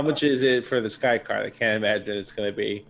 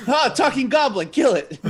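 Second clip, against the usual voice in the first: mock alarmed shout; 4 dB louder than usual. Player mocks NPC reaction.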